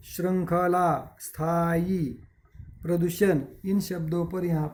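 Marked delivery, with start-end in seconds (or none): none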